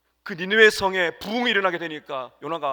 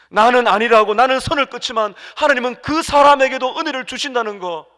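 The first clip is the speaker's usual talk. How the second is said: high, loud fervent preaching burst; preaching shout; 8 semitones higher, 13 dB louder than usual. Real, not acted. 회중에게 외치는 열정적인 설교. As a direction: pastor crying out to the congregation, loud and high.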